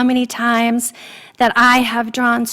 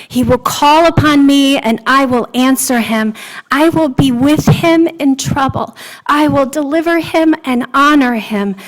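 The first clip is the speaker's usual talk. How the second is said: scripture reading; 4 dB louder than usual. Shown as a demonstration. Preacher reading the Lord's promise to the congregation, proclaiming. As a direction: read the psalm out with ringing, louder emphasis.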